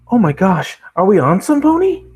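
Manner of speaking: high, loud squeal; thrilled pony squeal; excited squeal. Acted. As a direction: gasp with delight.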